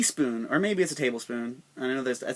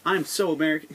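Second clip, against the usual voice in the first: mock angry patriot; 2 semitones higher than usual. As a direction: ham up an outraged flag-waver ranting. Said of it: "They'll be like".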